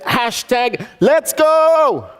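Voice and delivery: loud, high, slow holler; hollered like a hype chant; war cry shout. Retold or imitated. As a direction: stretch it into a long rallying cry. Repeats the war cry to Kenny.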